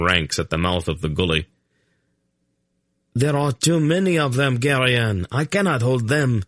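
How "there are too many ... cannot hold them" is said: urgent raised call; shouted warning. A narrator's reading. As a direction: shout over the battle noise. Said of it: "he shouted".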